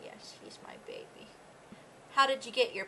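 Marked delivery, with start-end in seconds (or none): 0.0-1.3 soft whispered fond words; whispered to dog. Real, not acted. Owner whispering fondly to her dog Kobe. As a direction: whisper it into the dog's ear.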